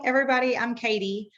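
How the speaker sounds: ordinary delivery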